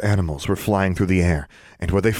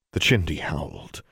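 ordinary delivery

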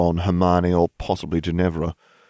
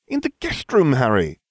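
put-on high, indignant girl; young witch cries out; Hermione's voice; 7 semitones higher, 3 dB louder than usual. A narrator's reading. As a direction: exclaim it, scandalised. Hermione exclaims.

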